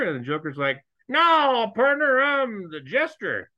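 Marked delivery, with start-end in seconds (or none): 1.1-3.5 high, loud hammed-up Texas drawl as the disguised Joker; Texan accent. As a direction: play a fake rich Texan with a big cowboy twang. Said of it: "rich Texan kind of".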